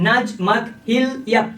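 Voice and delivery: slow, separated syllables: নাজ মক হিল yup; sounding out code words. Shown as a demonstration. Teacher modelling how to say the code words, slow. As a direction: drag out each code word clearly. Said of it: "এইভাবেই আসলে উচ্চারণ করতে হয়".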